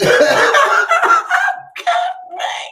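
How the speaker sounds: high-pitched helpless cackling; high squealing laugh. Real, not acted. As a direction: lose it in a high shrieking laugh. Laughing at his own story.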